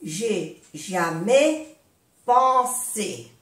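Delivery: "J'ai jamais pensé" slowly, with pauses between pieces; slow breakdown. Shown as a demonstration. Teacher modelling for learners, "J'ai jamais pensé" slowly in pieces.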